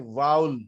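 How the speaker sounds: واول as a single "vowl" glide; correct pronunciation. Shown as a demonstration. Teacher showing the word to students, pronounced VOWL. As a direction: rhyme واول with "owl", no second v. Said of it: "تو اس کا pronunciation ہے".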